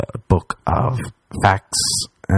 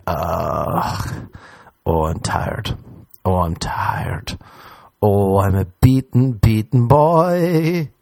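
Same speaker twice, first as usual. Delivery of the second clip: mock exhausted groan; much slower than usual. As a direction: moan it like you're spent. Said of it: "And now I'm like".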